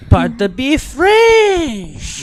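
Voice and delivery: hammed-up French accent; lightly put-on French accent. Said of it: "my French accent comes out".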